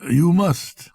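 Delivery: agitated. Acted. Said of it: "he said in agitation".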